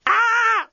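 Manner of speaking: very high scream; robot scream. Acted. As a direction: let out a sudden shriek. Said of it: "screamed the desk bot".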